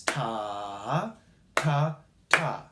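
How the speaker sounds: first "ta" held long, next two "ta" short; "ta" stretched, then two short beats of "ta"; long-short-short rhythm. Shown as a demonstration. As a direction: chant "ta" drawn out, then "ta, ta" briefly. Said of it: "Here's a long sound".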